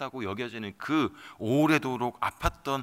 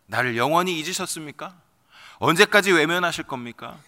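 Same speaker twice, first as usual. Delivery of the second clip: rising from pleading to higher, louder cry; building outcry; 4 semitones higher, 8 dB louder than usual. Acted. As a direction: climb into a desperate wail. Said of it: "이 부르짖음, 이 절규는".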